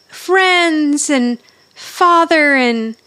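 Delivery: slow, drawn-out words climbing high; hammed-up, stretched sentimental list; gushing imitation. Imitating Angela's gushing moment.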